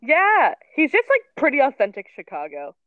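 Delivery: loud, eager agreement; excited agreement. Real, not acted. Enthusiastic agreement about Joe.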